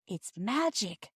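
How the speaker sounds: slow, soft, breathless awe; hushed wonder, slowly. Acted. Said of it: "said Phyllis breathlessly".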